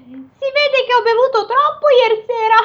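high ogre voice with a laugh. Acted. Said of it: "questa l'ho aggiunta io la risata".

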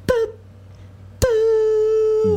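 high boop, boop like a heart monitor; monitor beep noise. Pretend. Friend mimics the hospital machine as the plug is pulled, high beeps. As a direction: mimic the life-support machine beeping high.

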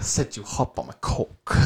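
mock British rapper accent; clowning British rap line. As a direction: rap it like a Brit, jokingly. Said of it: "this is a British rapper".

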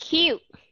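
CUTE punched high and loud with a y-glide, kyoot; correct pronunciation. Shown as a demonstration. Teacher giving the word that needs the difference, emphatic model pronunciation. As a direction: hit "cute" as k-yoot. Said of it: "bắt buộc chúng ta phải có cái sự khác biệt".

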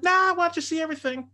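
high, loud mock imitation of his wife. Mocking his wife's carefree answer, high and loud.

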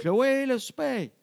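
high, slow, drawn-out dinner call; loud calling. Retold or imitated. Parent calls Chloé to supper, loud drawn-out call. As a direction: holler upstairs to a child. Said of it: "on a crié assez fort".

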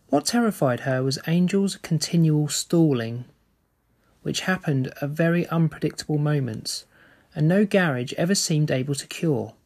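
steady read-aloud storytelling voice; story narration. A narrator's reading. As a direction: read the novel to the listeners. Reads a novel aloud.

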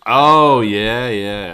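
loud, high "oh" of realization; a burst of real recognition; sudden realization. Real, not acted. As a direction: it suddenly clicks for you. Sudden realization mid-chat.

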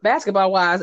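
usual speaking voice

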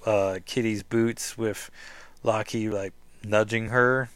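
ordinary delivery

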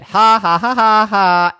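high, loud acted ha-ha; teasing brother's laugh. A narrator's reading. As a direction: laugh like a prankster revealing himself. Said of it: "out popped brother and said".

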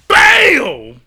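loud, high "Boom!" punched out; slam sound effect. Retold or imitated. Describing hitting the mat, loud mock impact sound. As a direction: bark the impact like a crash. Imitating being slammed down.

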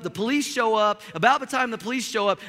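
ordinary talk, nothing marked

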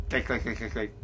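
clicking like a register; cash register clicks. Retold or imitated. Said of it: "That's my, uh, register impression".

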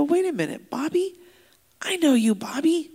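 retold startled recognition, voice jumping up; surprised recognition. Pastor spotting an inmate he knows, surprised. Recognizes former student in prison.

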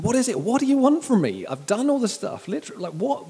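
high, loud frustrated demand; exasperated Paul. Acted. Apostle demanding what Corinth wants, exasperated. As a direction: throw up a pleading protest, pitched high. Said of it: "Paul in this is just going".

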